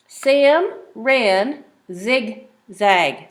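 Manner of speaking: very slow, each word apart; slow reading. Shown as a demonstration. Teacher reading the page slowly for the child. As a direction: read it out word by word. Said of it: "Let's read the words".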